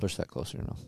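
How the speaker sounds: whispered aside. Real, not acted. Aside to co-host near the mic.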